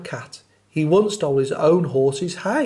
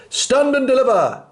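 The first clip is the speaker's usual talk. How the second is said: high, loud bandit roar; thundering; 10 semitones higher, 6 dB louder than usual. Acted. Rat the robber stopping the squirrel with a roar. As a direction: bellow it to stop the traveller. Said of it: "who thundered".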